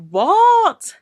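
high, loud disbelief; incredulous outburst. Real, not acted. Disbelief at the quoted remark.

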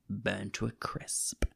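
slow hushed whisper. Real, not acted. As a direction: let it fall to a whisper. Dramatic whisper in retelling.

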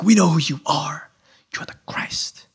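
whispery, reverent reply; acted-out hushed recognition; hushed awe. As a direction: say it under your breath as a revelation. Disciples' hushed answer to Jesus.

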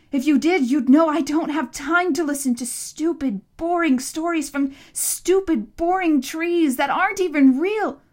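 high, loud, scornful tirade; angry rant. A narrator's reading. Boy scorning the monster's stories, angry rant. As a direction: pile up the insults, loud and high.

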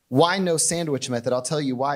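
normal talking voice

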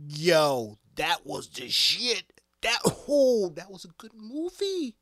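loud, high, hyped-up yell about the movie; excited shout. Real, not acted. Fan shouting to viewers about the movie, excited. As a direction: blurt it out like you just left the theater.

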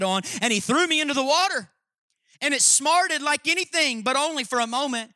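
high, loud storytelling voice; dramatic reading. Acted. Preacher reading Lewis to his church, high and loud. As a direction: read it big and impassioned. Reads Eustace's story aloud.

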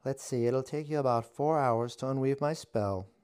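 high, loud mock-villain witch. A narrator's reading. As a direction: play it pitched high and loud. Witch voice held.

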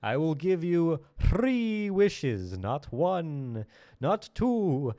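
high, slow, booming genie voice; genie vampire voice. Acted. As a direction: play a grand vampire genie. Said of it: "I am the genie vampire".

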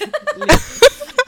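high loud burst of laughter; shrieking laugh. Real, not acted. Laughs at joke.

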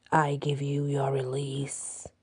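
slow, soft, rasping whisper from a creature; ragged whisper. A narrator's reading. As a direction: breathe it out low and ragged. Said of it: "she said in a ragged voice".

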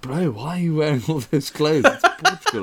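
a hint of a mate teasing; mate's voice. Mate mocks him for his many clothes, lightly voiced.

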